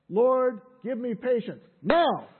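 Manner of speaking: loud plea, shouting the last word; impatient mock prayer. Praying for patience but unwilling to wait, loud and impatient. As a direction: pray it as an urgent demand.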